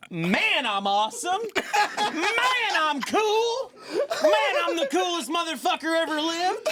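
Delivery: smug self-praise building up. Retold or imitated. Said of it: "you go".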